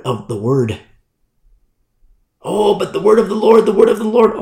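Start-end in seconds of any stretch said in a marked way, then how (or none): 2.4-4.3 lightly mocking higher-pitched protest; a hint of a fervent believer, raised pitch; mock objection. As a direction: play an over-eager churchgoer objecting. Mimics others' pious objection.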